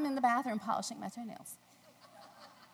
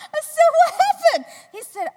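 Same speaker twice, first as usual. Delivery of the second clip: laughing; 17 dB louder than usual. Real, not acted. Laughing while retelling.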